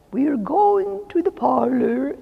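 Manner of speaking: high, slow, prim and self-important announcement; officious. Retold or imitated. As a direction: lift the pitch and pronounce it like a little official. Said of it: "she just seemed so officious".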